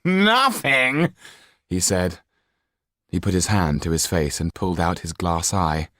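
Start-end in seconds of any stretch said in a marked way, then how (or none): 0.1-1.1 high, mocking repeat. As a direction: echo the word with a sneer. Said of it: "Joe burst out laughing".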